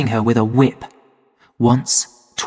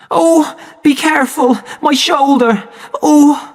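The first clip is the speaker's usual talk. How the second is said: high, sharp cry of pain; 12 semitones higher than usual. A narrator's reading. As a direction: wince and cry out, hurt. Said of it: "Linda cried out".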